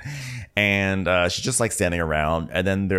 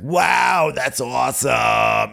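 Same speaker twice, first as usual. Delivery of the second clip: loud, slow, pitched-up wow; loud impressed wow; 5 semitones higher, 5 dB louder, much slower than usual. Retold or imitated. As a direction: cheer it out, impressed. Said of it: "she's like".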